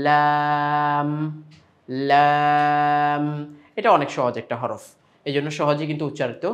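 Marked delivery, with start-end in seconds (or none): 0.1-3.2 লাম drawn out very slowly with a clear, held l; Arabic lam demo. Shown as a demonstration. Teacher modelling the letter lam slowly for students. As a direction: stretch লাম, the tongue edge on the front gums. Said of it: "সামনের দাঁতের মাড়ির সঙ্গে গিয়ে লাগবে".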